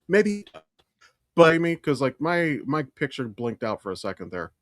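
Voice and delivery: ordinary talk, nothing marked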